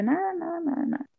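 high, soft string of denials; retold brush-off. Retells brushing off partner's questions.

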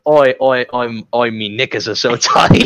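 mock Australian accent through laughter; laughing Aussie voice reading a comic line. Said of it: "hentai comics ... in Australian".

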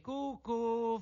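high, sing-song taunt; high-pitched mocking call. A narrator's reading. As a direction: tease like a cuckoo call. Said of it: "in segno di canzonatura".

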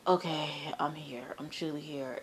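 breathy whisper, barely voiced; a dazed whisper on waking from the dream; hushed whisper. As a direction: whisper it to yourself, stunned.

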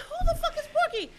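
high, laughing, incredulous question; shocked retort. Retold or imitated. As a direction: laugh it out in disbelief. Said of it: "I said".

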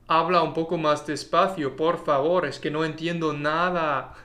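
quick, native-sounding Spanish; Spanish phrase. Shown as a demonstration. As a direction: rattle it off like a Spaniard. (schnell gesprochenes Spanisch)

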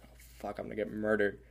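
breathy mock dread under the breath; mock panicked whisper. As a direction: hush it like a scared thought. Fearful inner thought at slow car.

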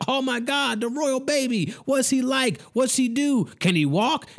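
put-on high, breathless gushing over the royal baby; mock gushing fan. Ranter mocking news fans, high gushing squeal. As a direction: mimic a starstruck fan squealing about the baby. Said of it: "y'all are just like".

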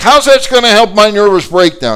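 high, loud exasperated protest; beside herself. Retold or imitated. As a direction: wail the question at the pastor. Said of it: "She's really beside herself".

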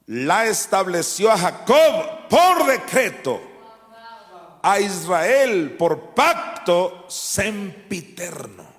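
high, loud, very slow proclamation; fiery preaching. Acted. Preacher reading the covenant to the church, slow and loud. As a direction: declare each word like a decree.

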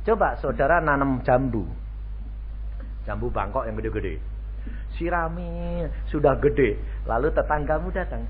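ordinary talk, nothing marked

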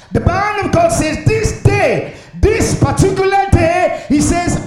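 high, shouted pulpit cry; full-force preaching. Real, not acted.

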